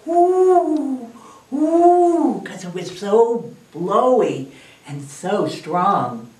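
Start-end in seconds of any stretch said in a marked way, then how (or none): 0.0-2.4 long, high, loud wind "whoo"; howling wind. Shown as a demonstration. Teacher shows children how the storm sounded, howling whoo. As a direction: imitate a howling gust, swelling and falling. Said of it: "if he heard the wind howl".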